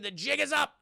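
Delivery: high-pitched acted confrontation; mock call-out.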